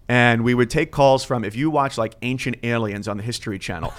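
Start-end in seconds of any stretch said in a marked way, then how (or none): none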